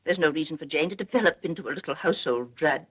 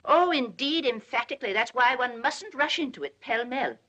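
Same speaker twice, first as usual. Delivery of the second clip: emphatic; 6 semitones higher than usual. Acted. Mother warning the young couple against rushing, emphatic. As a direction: press the point hard, pitched up.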